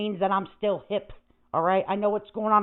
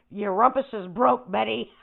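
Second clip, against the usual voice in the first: ordinary delivery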